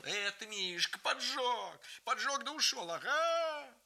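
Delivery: high, rising yell; frantic shout. A narrator's reading. Uncle Yakov yelling during the fire, frantic. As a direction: shout the accusation wildly. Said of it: "и кричал".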